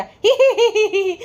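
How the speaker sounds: high sputtering hee-hee laugh with words through it; hammed-up cackling imitation, gushing between giggles; mock motor laugh. Imitating her nonstop background laughing while the chain was bought, mock motor laugh. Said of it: "motor laugh दे रही थी बाजा".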